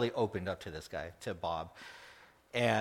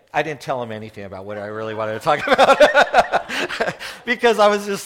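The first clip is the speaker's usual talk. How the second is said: laughing; 4 semitones higher, 15 dB louder than usual. Real, not acted. Laughs at his own story.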